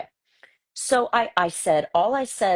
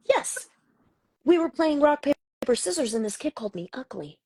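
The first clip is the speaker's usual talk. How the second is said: high-pitched imitation of a young son; child confessing; 11 semitones higher than usual. Son explaining himself to mom, childlike confession. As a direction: voice a kid owning up, starting high. Mother voices her son's confession.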